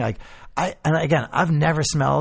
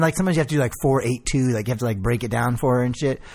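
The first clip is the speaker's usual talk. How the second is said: ordinary delivery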